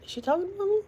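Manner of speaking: high squeak of disbelief; shocked. Retold or imitated. Retelling catching herself mentioned, shocked high pitch. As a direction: gasp it out in shock. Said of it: "I was shocked".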